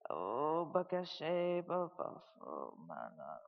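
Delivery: slow, murmured tongues syllables; fervent private prayer in tongues; praying in tongues. Shown as a demonstration. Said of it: "I ain't gonna pray in tongues".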